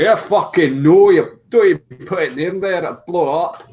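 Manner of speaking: put-on loud, high Scottish accent. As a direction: bark it in a thick Scottish accent. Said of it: "That was actually quite a good impression".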